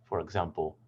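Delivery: ordinary delivery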